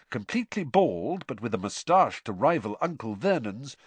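usual speaking voice

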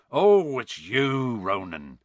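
long loud "oh" settling down in relief; relieved. A narrator's reading. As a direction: let the tension go on a drawn-out greeting. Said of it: "said Hagrid in relief".

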